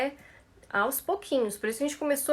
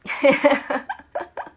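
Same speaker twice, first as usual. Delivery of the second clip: laugh; 3 semitones higher, 7 dB louder than usual. Real, not acted. Laughs at the class.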